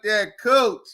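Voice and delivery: ordinary talk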